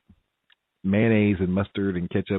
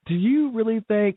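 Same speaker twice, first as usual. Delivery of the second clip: incredulous self-talk; 13 semitones higher, 3 dB louder than usual. Retold or imitated. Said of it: "this is what I'm saying to myself".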